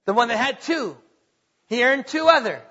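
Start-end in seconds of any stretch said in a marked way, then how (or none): none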